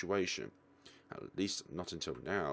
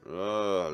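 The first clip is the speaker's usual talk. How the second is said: a louder, drawn-out groan of annoyance; frustrated groan; 6 dB louder than usual. Acted. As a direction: groan like you can't take it anymore. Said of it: "let out another groan".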